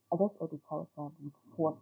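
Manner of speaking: ordinary talk, nothing marked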